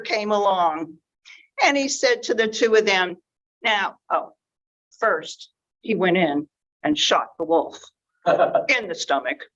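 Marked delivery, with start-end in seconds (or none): none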